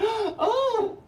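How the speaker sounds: high, loud shocked "Oh!"; high startled yelp; shocked gasp. Retold or imitated. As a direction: gasp it out in horror. Lady realises, gasp.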